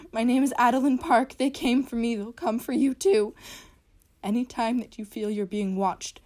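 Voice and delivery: terrified, desperate screaming plea; Adeline Park screaming for help. Acted. Victim begging for help.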